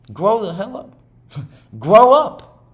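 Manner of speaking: slow, then a high laughing blurt; mock scold. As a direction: scold yourself, half laughing. Telling himself to grow up.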